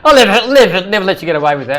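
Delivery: loud, fast laughing protest; mock indignant. Real, not acted. Boss teasing the host, mock outrage. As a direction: playfully refuse to let the jab slide. Teasing banter about sloppy form.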